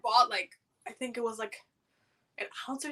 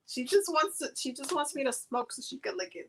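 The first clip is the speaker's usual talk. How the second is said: ordinary talk, nothing marked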